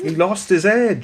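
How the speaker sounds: slow, high attempt at a Norfolk accent, dropping the h; a failed stab at Norfolk; mock Norfolk accent. Friend mocking the Norfolk article in a bad Norfolk accent. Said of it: "I can't, I can't do it".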